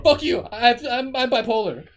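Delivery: very loud, high defensive outburst; mock yelling. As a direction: lash back in hammed-up outrage. Acted excuse yelled back at critic.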